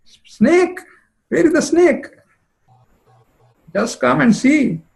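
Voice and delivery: very high, startled cry on snake; shocked alarm. Acted. Jatila hears of the snakebite, in high startled alarm. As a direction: shriek the question in sudden panic.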